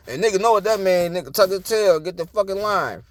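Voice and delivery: usual speaking voice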